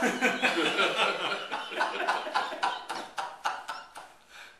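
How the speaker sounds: loud laugh at own joke; hearty laugh. Real, not acted. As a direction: burst out laughing. Teller laughs at punchline.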